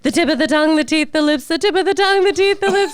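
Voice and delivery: chanted diction exercise, pitch stepping up; sing-song vocal warm-up. Shown as a demonstration. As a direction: build a rhythmic warm-up chant, rising with each round. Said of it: "Just sing it".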